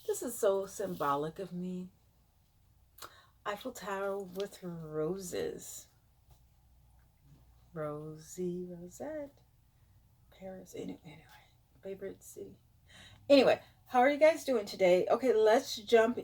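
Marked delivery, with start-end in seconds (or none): 3.5-5.9 slow, softer musing; hushed dreamy repeat. Real, not acted. Dreamy repeat of her emblem. 7.7-9.3 slow, much softer; hushed, dreamy self-naming; breathy dreamy name. Real, not acted. Dreamy repeat of her name. 10.4-12.6 hushed whispered aside; soft secret whisper. Real, not acted. Hushed aside about Paris.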